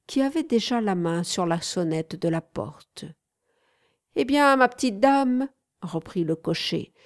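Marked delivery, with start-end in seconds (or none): 4.2-5.5 loud, high cheeky coachman; cheeky cabbie retorting to the lady. A narrator's reading. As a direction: answer her like a cheeky cabbie.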